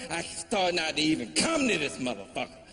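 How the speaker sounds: normal talking voice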